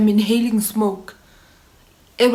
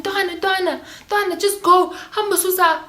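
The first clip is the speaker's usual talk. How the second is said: friend urging; 9 semitones higher than usual. Retold or imitated. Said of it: "I remember someone saying".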